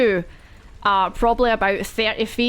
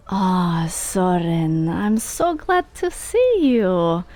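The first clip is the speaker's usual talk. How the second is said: slow, eerie villain whispering close; mentor's whisper; slower than usual. Acted. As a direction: lure him in, slow and creepy. GM voices mentor whispering to Soren.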